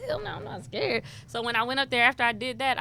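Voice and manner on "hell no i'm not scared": high, mock-tough denial; defiant bravado. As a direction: brush off the fear with swagger. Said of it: "And I was just like".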